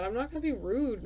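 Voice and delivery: ordinary delivery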